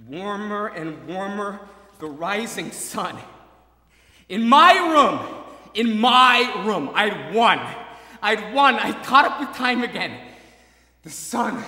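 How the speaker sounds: 3 yell it out like you've won; 4 overwhelmed joy at feeling sunrise; 1 ecstatic shout. Acted. Sunrise felt, ecstatic triumph.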